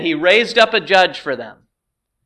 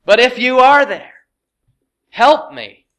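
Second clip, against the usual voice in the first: loud, high plea to God; doubting cry for help; crying out in prayer; 5 semitones higher, 7 dB louder than usual. Acted. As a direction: call out to the Lord, raised and pleading. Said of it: "Often we cry out".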